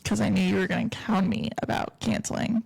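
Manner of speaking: ordinary delivery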